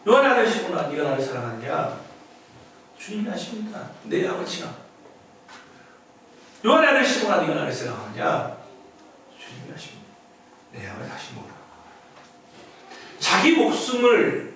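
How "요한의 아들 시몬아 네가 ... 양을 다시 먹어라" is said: much lower and much softer, nearly whispered in places; hushed tender dialogue. Acted. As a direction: let the exchange fall to a tender hush. Jesus and Peter dialogue retold.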